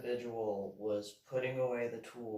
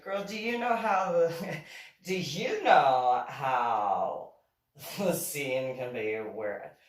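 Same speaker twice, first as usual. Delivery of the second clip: imitating a gushing woman; 10 dB louder, slower than usual. Woman quoted, admiring.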